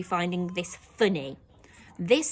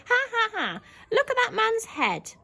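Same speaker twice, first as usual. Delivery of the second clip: high laughing voice; laughing girl; 13 semitones higher, 4 dB louder than usual. Shown as a demonstration. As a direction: giggle at the man's head. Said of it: "apostrophe S".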